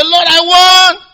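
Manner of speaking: loud, high pleading cry; a believer crying to God, loud plea. Pretend. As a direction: cry to the Lord with hunger. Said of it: "You're gonna cry".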